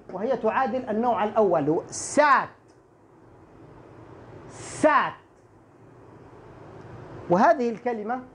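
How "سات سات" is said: ست with an open alef vowel, written سات; pronunciation demo. Shown as a demonstration. Teacher models "sat" for students, open-vowel pronunciation. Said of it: "سين ألف تاء".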